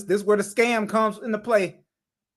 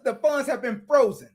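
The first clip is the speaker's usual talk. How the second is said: usual speaking voice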